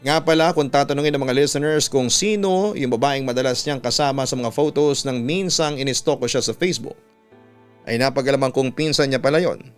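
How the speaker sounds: usual speaking voice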